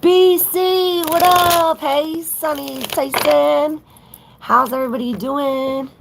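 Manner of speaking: high, loud sing-song hello to the chat; hyped livestream welcome; excited greeting. Real, not acted. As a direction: call out big and bright to the viewers. Live tarot stream greeting viewers.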